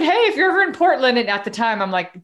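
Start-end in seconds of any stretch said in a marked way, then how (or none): 0.0-1.2 much higher, louder, fast invite; eager invitation. Retold or imitated. Inviting a new friend to visit, much higher, louder and fast. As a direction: blurt the invite out brightly.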